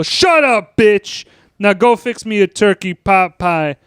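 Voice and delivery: high, loud bark of shut up; angry father. Retold or imitated. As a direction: order her around with contempt. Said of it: "Oh, mine? It's real easy".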